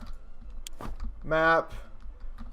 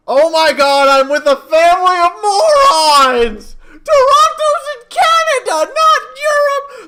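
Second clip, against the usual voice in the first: very high, loud, laughing and wailing; exasperated laughing shout; 10 dB louder than usual. Real, not acted. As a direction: shriek in laughing disbelief. Exasperated at family's geography.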